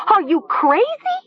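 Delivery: piercingly high repeated shriek. A narrator's reading. Nessa tries to stop Talia, shrieking. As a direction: screech the question frantically. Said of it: "Nessa shrieked".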